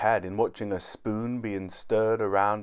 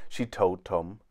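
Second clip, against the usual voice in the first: ordinary delivery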